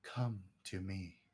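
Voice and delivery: low, soft, ghostly call; eerie echoing voice. Acted. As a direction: beckon like a spirit from the plants. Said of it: "an echoing voice from all of the plants".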